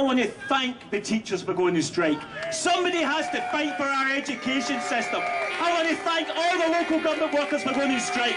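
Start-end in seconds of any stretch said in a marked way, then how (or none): 2.5-8.3 raised, higher rallying cry to the crowd; rally shout. Real, not acted. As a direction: call it out over the crowd, fired up. Rally speaker rousing the crowd.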